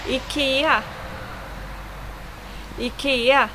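IKEA as "ee-KEH-a"; Swedish IKEA. Shown as a demonstration. Teacher showing viewers the name IKEA, Swedish pronunciation. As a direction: model IKEA in Swedish, word on its own. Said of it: "words that I should, uh, pronounce".

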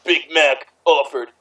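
plain reading voice

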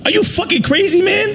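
high-pitched, incredulous outrage; outraged. Real, not acted. Man berating someone for stepping on his vet, outraged. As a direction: pitch jumping way up in angry disbelief.

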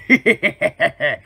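quick villainous cackle; evil laugh. Acted. Villain luring victim to his lair, cackling. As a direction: cackle in premature triumph.

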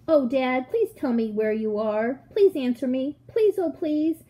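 put-on child pleading, rising in pitch; trembly pleading. A narrator's reading. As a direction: beg for an answer, close to losing hope. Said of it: "my voice began to go all trembly".

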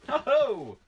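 high, loud burst of glee; pleased exclamation at a find; delighted whoop. Real, not acted. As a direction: whoop with sudden joy. Delight at finding Voyager cover.

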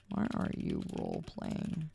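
whispered repeat of the question; whispered aside in disbelief. Real, not acted. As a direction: drop to a whisper, half to yourself. Repeats the question under breath.

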